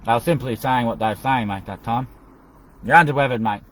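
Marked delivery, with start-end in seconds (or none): 2.8-3.6 calling out. Acted. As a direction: call it out loud to him. Same phrase called out again.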